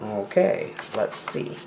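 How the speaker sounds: soft whispered okay let's see; whispered aside. Acted. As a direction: breathe it to yourself.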